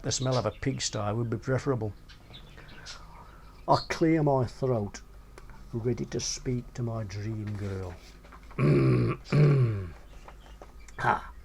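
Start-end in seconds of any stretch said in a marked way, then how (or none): none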